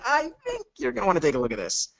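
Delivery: intriguing invite. Shown as a demonstration. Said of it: "if I called you up and I was like".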